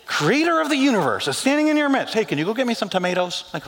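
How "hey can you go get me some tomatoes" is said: quick, offhand errand request; mock casual request. Mary treating Jesus like the gardener, quick and offhand. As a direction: toss it off to the gardener.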